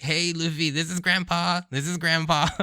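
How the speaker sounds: a hint of Garp calling out; mock grandpa call. Reviewer translates Garp's greeting, lightly voiced.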